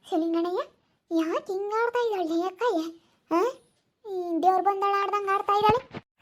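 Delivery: puzzled village woman. Acted. Another woman asks about Jayamma. (ಮೆಲ್ಲಗೆ ಕೇಳುವ ಹಳ್ಳಿ ಹೆಂಗಸು)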